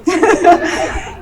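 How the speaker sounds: high, choked-up laugh breaking through; a laugh that is half tears; emotional laugh. Real, not acted. Librarian thanking her family at the groundbreaking, choked-up laugh. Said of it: "this is where I'm gonna get emotional".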